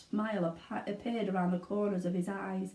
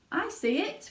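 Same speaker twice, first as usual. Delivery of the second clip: excited cry; 10 semitones higher than usual. Acted. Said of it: "cried Mister Wonka".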